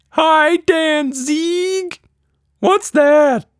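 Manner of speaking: very high, slow, earnest creature question; puzzled tiny monster asking in all seriousness; serious little monster. Acted. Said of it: "apparently very serious".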